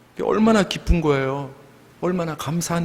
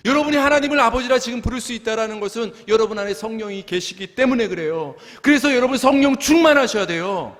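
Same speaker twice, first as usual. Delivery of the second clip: high, sustained, urgent pulpit delivery; fervent preaching; 7 semitones higher than usual. Real, not acted. Pastor urging congregation to be Spirit-filled, fervent preaching. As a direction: exhort the congregation with full fervor.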